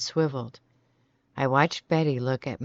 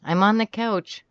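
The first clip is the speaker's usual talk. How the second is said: raised, higher calling voice; calling to someone; 7 semitones higher, 5 dB louder than usual. A narrator's reading. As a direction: call across the room. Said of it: "I called to her".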